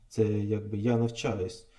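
ordinary talk, nothing marked

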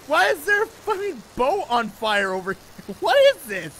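high, loud laughing question; laughing disbelief. Real, not acted. Laughing at burning boat.

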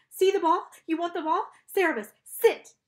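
high, loud dog-command shout. A narrator's reading. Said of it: "She shouted".

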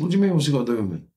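very fast, high-pitched comeback; rapid incredulous retelling of an argument; acted indignation. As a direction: fire back in mock outrage.

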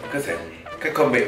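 ordinary delivery

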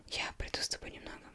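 ordinary delivery

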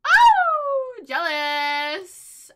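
high, loud, drawn-out exclamation; scandalised reaction. Real, not acted. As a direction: exclaim it long in delighted shock. Reacts to jealousy in game.

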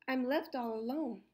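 plain reading voice

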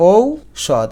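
ঔষধ slow, "ou... shod"; syllable by syllable. Shown as a demonstration. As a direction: draw out the first diphthong of ঔষধ. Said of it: "ঐর উচ্চারণ ও আর ঔ". (ঔষধ, ধীরে টেনে ভেঙে)

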